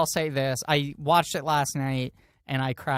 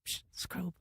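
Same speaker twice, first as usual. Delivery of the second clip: unvoiced hushed whisper, sneaking; whispered call; 16 dB quieter than usual. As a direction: hiss his name under your breath. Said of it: "he goes like".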